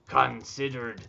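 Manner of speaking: slow, deliberate pirate dictation; stretched-out pirate phrasing, typed as spoken. Acted. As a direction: pronounce each word slowly as a pirate. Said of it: "now that it's pirate stuff".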